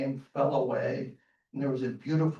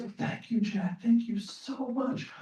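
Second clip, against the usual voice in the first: put-on a starving old man crying over bread; tearful thanks; 8 semitones higher than usual. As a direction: whisper your thanks through tears.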